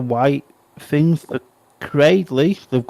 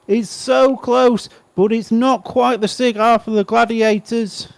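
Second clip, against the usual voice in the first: much higher, urgent play-by-play; live race commentary; 12 semitones higher, 3 dB louder than usual. Real, not acted. Commentator calling the final lap of a close race, excited live commentary. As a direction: call the last lap at a high pitch.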